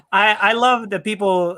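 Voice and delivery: ordinary talk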